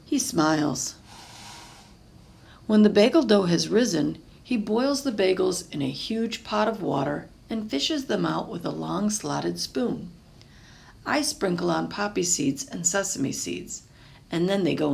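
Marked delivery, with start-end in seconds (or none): none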